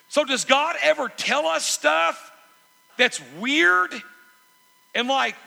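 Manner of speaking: loud, high rhetorical question; impassioned preaching. Real, not acted. Preacher asking whether God tells us weird stuff, impassioned preaching. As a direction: throw the question out at full force.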